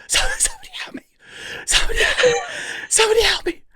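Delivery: high strained whisper, pleading for help; whisper scream. Retold or imitated. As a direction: imitate a hushed, squeaky cry for help. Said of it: "I think I hear some whispering".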